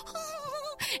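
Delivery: high, quiet imitated gasp; frightened gasp. Bully backing off from the boy, mock frightened gasp. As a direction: suck in air like a startled kid. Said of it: "And the kid go".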